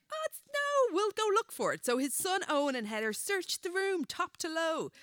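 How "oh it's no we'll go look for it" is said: lightly voiced wife, higher; a hint of a breezy wife; wife's voice. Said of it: "Heather was like".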